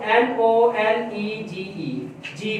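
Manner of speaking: each letter said slowly and apart; letter-by-letter dictation pace; spelling it out slowly. Shown as a demonstration. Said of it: "अगर knowledge के बच्चा spelling लिखेगा".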